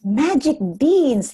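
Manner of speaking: put-on wondering boy. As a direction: savour the words with wonder. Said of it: "thought Jack".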